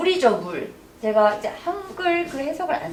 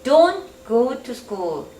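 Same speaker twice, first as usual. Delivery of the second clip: slow model read; much slower than usual. Shown as a demonstration. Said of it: "코로나 때문에 가지 마라".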